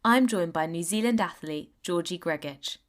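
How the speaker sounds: smooth scripted host intro; podcast intro read. Acted. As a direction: read it like a friendly radio host opening the show.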